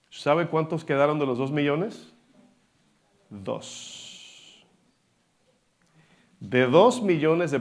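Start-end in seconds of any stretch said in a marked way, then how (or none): 3.3-4.7 breathy hushed whisper, almost no voice; dramatic whisper. Acted. Preacher to the congregation, revealing only two survived, in a whisper.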